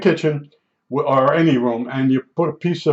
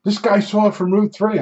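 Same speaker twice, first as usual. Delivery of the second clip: higher-pitched imitation of an excited man; mildly excited mock voice, pitched up; 5 semitones higher than usual. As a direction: retell it as an excited announcement to others. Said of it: "He's getting all excited".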